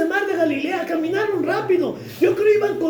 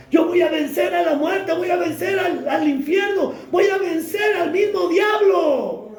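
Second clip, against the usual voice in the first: triumphant proclamation. Acted. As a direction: proclaim victory over death with full force. Jesus proclaims victory, preacher shouting.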